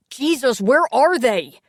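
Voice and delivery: loud and high, close to panic; desperate. A narrator's reading. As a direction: plead for help to arrive. Waiting desperately for ambulance.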